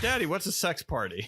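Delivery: high-pitched mock little-boy question; imitating a small son asking dad; innocent child. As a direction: ask it like a curious kid. Voices the six-year-old son asking.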